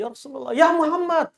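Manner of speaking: high-pitched mock calling out; imitated distant shout. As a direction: call out as if from afar, without full volume. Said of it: "Dia dari jauh teriak pada Nabi".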